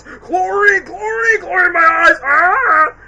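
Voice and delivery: very high, loud cartoon screaming; mock pained shrieking. Acting out character hurt at pool.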